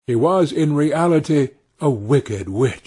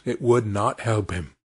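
ordinary delivery

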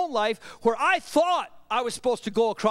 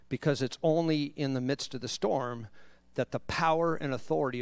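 low, soft, measured preacher's voice; hushed solemn preaching; 11 semitones lower, 6 dB quieter than usual. Real, not acted. Preacher reads Jesus calming the storm to congregation, hushed and solemn. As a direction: drop it low and quiet, with long pauses.

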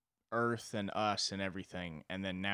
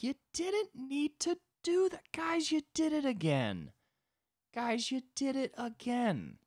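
very high, slow mock plea; dismayed God; slower than usual. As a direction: stretch it out high, like an exasperated creator.

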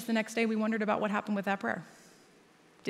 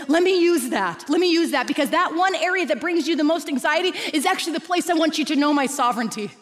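voicing God; 6 semitones higher, 7 dB louder than usual. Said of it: "He's going".

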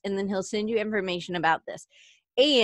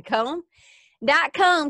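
put-on high, loud joking Southern accent on dot com; 7 semitones higher, 6 dB louder, much slower than usual. Podcast host joking over the web address, Southern accent. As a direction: play up a Southern accent for fun. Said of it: "I have to do that with a Southern accent".

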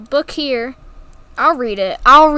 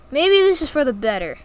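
ordinary talk, nothing marked

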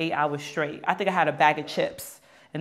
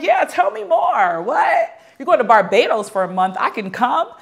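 high, loud, hammed-up party enthusiasm; eager excitement; 9 semitones higher, 6 dB louder than usual. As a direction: build to a big, whooping yes. Gobbling wine, eagerly accepting invitation.

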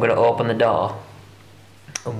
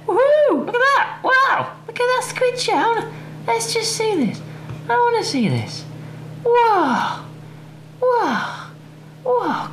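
very high-pitched wows and woohoos; excited squeal. Real, not acted. Vlogger spots a squid chair online, squealing with excitement. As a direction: gasp over it in a high, excited voice.

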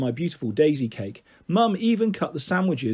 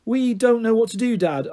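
desperate; 6 semitones higher than usual. A narrator's reading. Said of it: "I said desperately".

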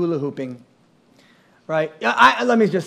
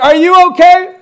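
high, loud alarm; worried wife; 12 semitones higher, 12 dB louder than usual. Retold or imitated. Wife on the coaster, alarmed that he will faint. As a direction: call out to him in a worried rush.

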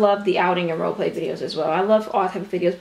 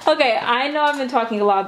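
normal talking voice

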